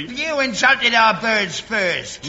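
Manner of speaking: angry retort. Acted. Old soldier refusing the Frenchman an apology, slow angry retort. Acted quarrel, hurls insult back.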